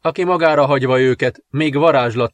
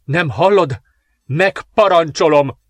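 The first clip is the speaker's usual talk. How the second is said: higher, forceful royal order; yelled command; 5 semitones higher, 4 dB louder than usual. A narrator's reading. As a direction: order her with raised, insistent voice. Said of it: "üvöltötte Patricia". (parancsolóan felkiáltva)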